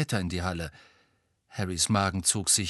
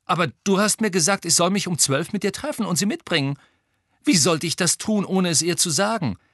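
indignant protest; 9 semitones higher, 5 dB louder than usual. Acted. Harry empört sich weiter.

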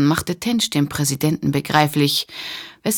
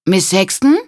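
calling out; 4 semitones higher, 5 dB louder than usual. A narrator's reading. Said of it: "rief die vermummte Gestalt herauf".

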